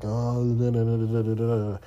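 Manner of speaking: mock gibberish excuses. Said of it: "And they all like".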